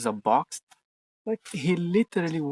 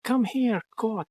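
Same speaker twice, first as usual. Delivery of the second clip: much higher coaxing call to the pet; calling the cat; 9 semitones higher, slower than usual. Real, not acted. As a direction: coax the cat to come over.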